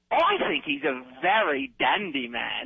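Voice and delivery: lightly voiced British charwoman accent. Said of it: "you sound like a British charwoman".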